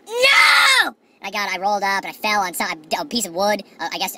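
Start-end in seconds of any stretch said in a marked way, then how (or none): 0.1-0.9 high, loud shouted no; retold shouted no on waking; mock shout. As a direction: yell it in alarm. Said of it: "I was like".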